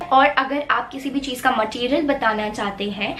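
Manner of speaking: normal talking voice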